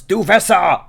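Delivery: high, loud call up to someone upstairs; shouting. Acted. Helen calls up to Duvessa whether anything is for sale, shouting. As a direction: holler it through the house, drawing out the last word. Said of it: "She turns around and shouts".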